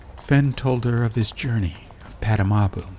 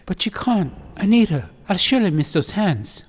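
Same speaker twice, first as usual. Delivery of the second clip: 1 pleading upset master; 10 semitones higher, 6 dB louder than usual. A narrator's reading. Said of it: "lip trembled".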